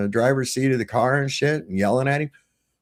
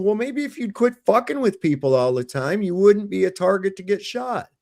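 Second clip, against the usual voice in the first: high-pitched scolding comeback; retold indignant retort; 9 semitones higher than usual. Father scolding supervisor after video, retold indignant retort. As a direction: lecture him back, pitched well up.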